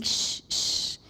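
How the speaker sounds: whispered shh, hushing; a man whispering shh to a little girl. Retold or imitated. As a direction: breathe the shh out with no voice.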